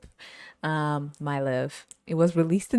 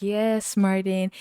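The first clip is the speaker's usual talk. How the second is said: mock dutiful wife; 4 semitones higher, slower than usual. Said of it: "and she'd be like".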